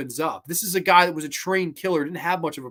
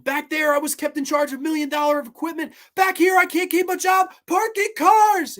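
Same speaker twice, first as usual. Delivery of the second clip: much higher, cracking anguished cry; breaking down; 13 semitones higher, 5 dB louder than usual. Retold or imitated. Veteran breaking down to his colonel, high and anguished. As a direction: let the voice break as he falls apart.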